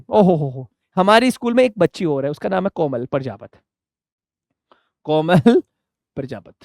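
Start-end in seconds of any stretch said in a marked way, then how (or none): none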